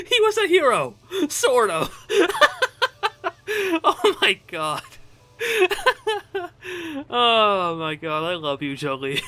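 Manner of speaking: high, loud words through real laughter; laughing hard. Real, not acted. Player repeating the story's punchline, laughing hard. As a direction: repeat it while helplessly cracking up. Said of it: "out of breath from laughing so hard at that story".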